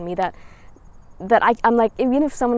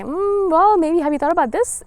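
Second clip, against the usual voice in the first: mock doubting skeptic; 7 semitones higher than usual. People voiced doubting you.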